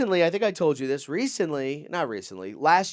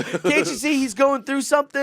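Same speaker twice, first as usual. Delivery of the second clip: very high, pleading retelling; exasperated plea; 12 semitones higher, 4 dB louder than usual. Retold or imitated. Bystander pleading for the homeless man, high exasperation. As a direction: plead in a comically pitched-up voice.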